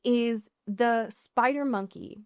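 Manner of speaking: ordinary delivery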